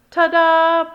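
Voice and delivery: high, loud held fanfare; fanfare blast. Acted. The band blasts a fanfare, loud. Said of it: "blasted La Banda".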